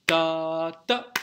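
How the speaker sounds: loud "Ta" held one beat on a level pitch, then short "ta"; one-beat syncopation. Shown as a demonstration. Teacher contrasts two rhythms, one-beat saute demo. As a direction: hold "Ta" evenly without a second pulse, then "ta". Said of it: "celui-ci dure un temps".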